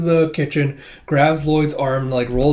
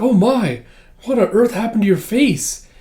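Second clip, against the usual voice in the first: high, louder gasp of concern in acting; shocked concern; 9 semitones higher, 5 dB louder than usual. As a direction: fuss over him in a raised pitch. Yor sees Lloyd's injured face.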